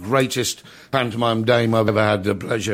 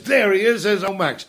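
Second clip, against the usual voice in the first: hearty greeting; 8 semitones higher than usual. Retold or imitated. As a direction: boom out a cheery welcome. Punter hailing Max at interval.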